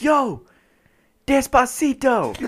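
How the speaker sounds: high, slow hammed-up yell out the window; high drawn-out holler; mock yell. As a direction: stretch it out like hollering at strangers. Yelling out car window.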